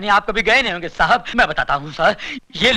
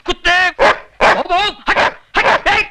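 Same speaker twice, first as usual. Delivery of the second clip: higher, louder shooing shout; shooing a dog; 7 semitones higher, 3 dB louder, slower than usual. Acted. To the stray dog that won't stop barking, loud shooing. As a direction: shoo the dog off, sharp and loud.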